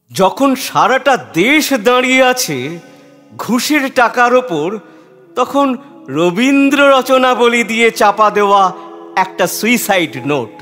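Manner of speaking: high, ringing declamation in sweeping phrases; impassioned declamation. A narrator's reading. Reciter setting the note against a country standing on bribes, impassioned declamation. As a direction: lift the voice and declaim it with force. Poem's climax after the letter ends.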